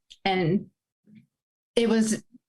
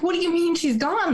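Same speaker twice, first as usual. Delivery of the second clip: stunned disbelief; 7 semitones higher than usual. Retold or imitated. Disbelief at news of death.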